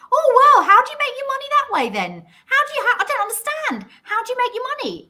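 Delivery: high incredulous imitation of a baffled stranger; mock puzzled questioner. YouTuber mocking a stranger who asks about money, high and baffled. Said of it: "this is what I always get".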